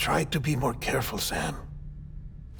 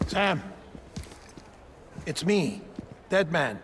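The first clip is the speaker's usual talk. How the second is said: calling out; 4 semitones higher than usual. Acted. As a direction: call out to get his attention. Deadman calls out to Sam.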